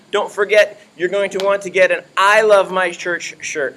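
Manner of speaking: ordinary talk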